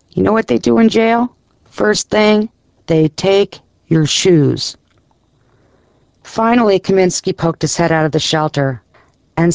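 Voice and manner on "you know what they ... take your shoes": high at first, slowing on the punchline; lightly voiced Midwestern sponsor driving the point home; Larry, Midwestern accent. A narrator's reading. Sponsor warning his charge about jail, lightly Midwestern accent. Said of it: "His sponsor Larry's Midwestern accent".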